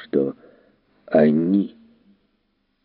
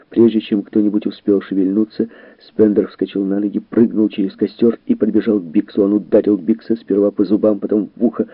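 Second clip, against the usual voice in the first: ordinary delivery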